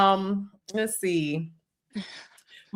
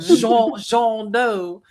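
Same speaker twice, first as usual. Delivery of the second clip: French accent; 6 dB louder, much slower than usual. Shown as a demonstration. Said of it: "I should've said it with a French accent".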